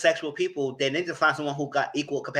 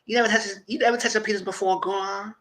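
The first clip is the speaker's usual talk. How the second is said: put-on teasing girl; 6 semitones higher than usual. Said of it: "shocked and embarrassed".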